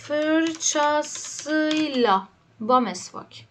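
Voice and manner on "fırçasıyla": very slow dictation. Shown as a demonstration. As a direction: stretch fırçasıyla out syllable by syllable. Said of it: "dictating word slowly".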